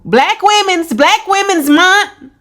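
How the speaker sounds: high, loud mock cheer; sarcastic. Commentator mocking a reality-show defender, loudly sarcastic. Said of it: "I'm just being sarcastic".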